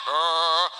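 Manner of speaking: low sustained "ah"; singing rock. Pretend. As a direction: drop to a low held note as a singing rock. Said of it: "those rocks start singing".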